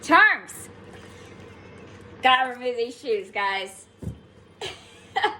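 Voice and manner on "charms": excited. Real, not acted. Excited find during reading.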